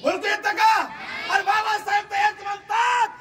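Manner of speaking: booming high preacher's cry to the crowd; fiery rally orator; preaching at full force. Acted. Preaching climax.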